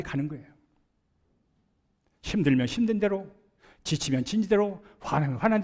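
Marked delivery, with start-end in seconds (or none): none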